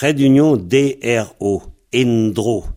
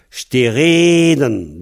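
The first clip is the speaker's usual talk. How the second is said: Stereden with a long, stressed E before the D; stress on the second-to-last syllable; much slower than usual. Shown as a demonstration. Teacher models Stereden for a Breton learner, stressing the long vowel. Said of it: "la voyelle est longue, c'est l'air accentué".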